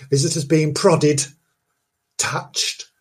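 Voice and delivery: ordinary delivery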